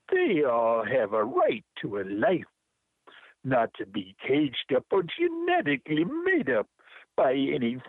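lilting Jamaican performance poetry; rhythmic Jamaican accent with swooping pitch and stretched rhymes. Acted. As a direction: recite in your best put-on Jamaican accent. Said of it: "I use my best Jamaican accent".

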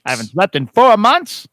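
loud booming newsreader; news announcer voice. Acted. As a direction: announce it like a hyped anchor. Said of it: "Bert Skwecky".

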